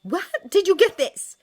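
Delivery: very loud, high, furious demand; escalated, yelled question; demanding shout. A narrator's reading. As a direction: shout the demand at full force. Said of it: "she demanded".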